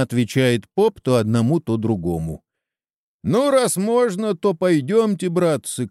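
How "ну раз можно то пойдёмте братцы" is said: high-pitched mild call to comrades; rallying shout. A narrator's reading. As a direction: urge the men on, pitch well up. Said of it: "крикнул Чеба".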